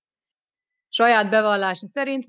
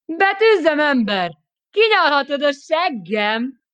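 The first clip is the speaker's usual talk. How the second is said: laughing taunt; 9 semitones higher, 5 dB louder, slower than usual. Acted. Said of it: "nevetett még hangosabban".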